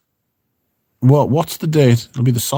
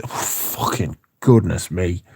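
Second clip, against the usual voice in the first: ordinary talk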